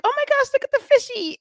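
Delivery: very high, fast, delighted gush over a fish; excited squeal. Retold or imitated. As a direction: squeal like a kid spotting something cute. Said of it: "and I'm like".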